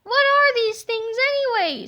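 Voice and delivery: put-on high curious boy voice; puzzled kid. A narrator's reading. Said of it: "said George".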